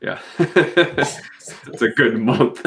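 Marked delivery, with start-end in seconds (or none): 0.2-1.6 laughing. Real, not acted. Laughing at sunlight joke.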